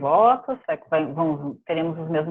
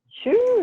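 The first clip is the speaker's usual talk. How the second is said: sound effect. Pretend. Drumroll reveal sound.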